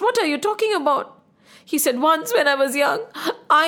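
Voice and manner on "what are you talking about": higher, quicker, lightly voiced query; a hint of a puzzled queen; questioning Kaushalya. A narrator's reading. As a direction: ask it quickly, pitched up. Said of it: "Kaushalya asked".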